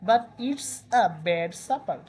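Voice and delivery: each word drawn out slowly; slow quote reading. Shown as a demonstration. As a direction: read the quote very slowly for students. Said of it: "reading a quote slowly to class". (ধীরে ধীরে উদ্ধৃতি পড়া)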